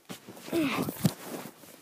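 loud throat clear. Real, not acted.

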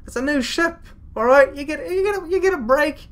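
high-pitched, indignant outburst; exasperated rant. Acted. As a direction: let exasperation send the voice way up. Indignant rant at the crew's nerve.

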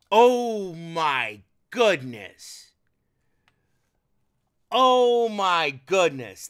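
slow, loud, marveling; delighted awe. Real, not acted. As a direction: savor each word in wonder. Marveling at flight stick.

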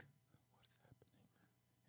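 whispered in disbelief. Retold or imitated. Pulled-over drunk to himself, stunned whisper. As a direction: breathe it out, barely audible. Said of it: "And I'm like".

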